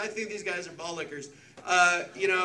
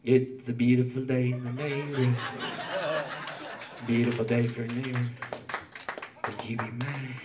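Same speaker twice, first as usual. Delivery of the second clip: Mister Rogers; 6 semitones lower than usual. Acted. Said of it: "Mister Rogers on his day off".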